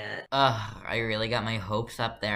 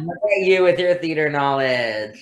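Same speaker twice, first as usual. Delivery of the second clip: excited; 6 semitones higher, 6 dB louder than usual. Real, not acted. Praising friend excitedly.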